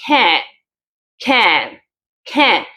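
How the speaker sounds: "can" with a full, open vowel; stressed full can. Shown as a demonstration. Teacher showing the stressed "can" to students. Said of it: "quiero resaltar la palabra".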